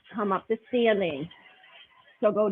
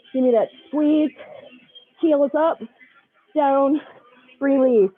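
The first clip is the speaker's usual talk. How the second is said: aerobics instructor; 5 dB louder than usual. Acted. Loud cueing through glute bridges.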